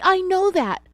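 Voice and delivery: a suspect screaming at the agent; narrated scream. A narrator's reading. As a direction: scream it back at him. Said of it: "Teresa screamed".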